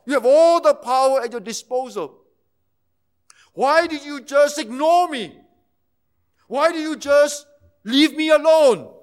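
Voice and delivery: loud, pleading questions to God; voicing Jesus, loud and anguished; anguished cry. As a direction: cry out, hurt and abandoned. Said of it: "He said".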